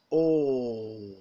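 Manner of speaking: "O" as a long, tense, rounded back aw vowel; model vowel sound. Shown as a demonstration. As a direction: hold "O" long with rounded lips, as in "fall". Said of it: "and rounded vowel".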